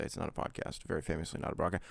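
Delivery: breathy under-the-breath aside; whispered aside. Real, not acted. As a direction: mutter it to yourself.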